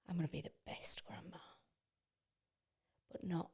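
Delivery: soft conspiratorial whisper; whispered like a guilty secret. Real, not acted. Confiding to her friend about grandkids, whispered.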